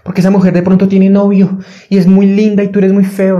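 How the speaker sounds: mock the doubting voice in his head; hammed-up nagging inner voice; mocking inner critic. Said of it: "esa voz que me decía".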